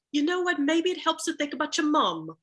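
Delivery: high, gentle mock British coaching voice; imitating British actor. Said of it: "the British actor was like".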